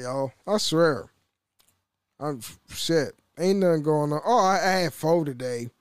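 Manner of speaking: ordinary delivery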